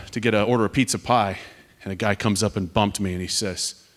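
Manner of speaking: ordinary delivery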